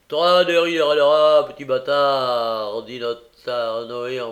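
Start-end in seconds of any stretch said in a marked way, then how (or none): 0.1-2.8 appel fort et lent, plus aigu; interpellation brutale. A narrator's reading. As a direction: apostropher l'orphelin avec mépris. Noé interpelle Olivier. (Noé apostrophe Olivier, fort et lent)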